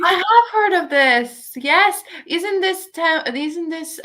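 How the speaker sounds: lighting up because she knows this story; excited recognition. Real, not acted. Reader suddenly recognizing the Death on the Nile temple, excited.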